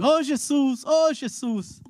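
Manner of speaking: high calling cry; mock crowd calling his name. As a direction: call out over a crowd.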